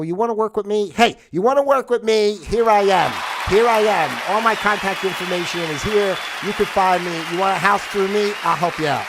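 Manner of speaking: high, loud announcer-style pitch; mock ad pitch. Pretending to advertise himself to buyers, a loud announcer pitch. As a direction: sell yourself like a TV ad. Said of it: "acting out his own pitch".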